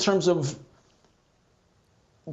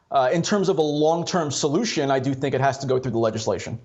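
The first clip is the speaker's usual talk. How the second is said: ordinary delivery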